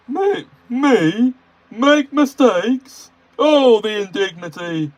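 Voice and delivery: high, loud, slow outraged engine; indignant Gordon. Acted. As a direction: huff like an offended grandee. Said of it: "said Gordon".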